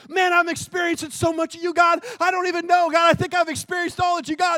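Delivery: high, loud excitement; acted overwhelmed believer. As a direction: gush to God in exhilarated amazement. Acts excited believer addressing God.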